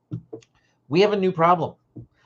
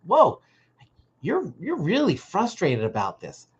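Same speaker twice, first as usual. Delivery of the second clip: curious, gentle; 5 semitones higher than usual. Shown as a demonstration. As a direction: show real curiosity about their frustration. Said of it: "going like".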